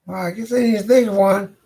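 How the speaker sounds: woman's voice. Acted. The lady at the door teases Fabian in a high voice.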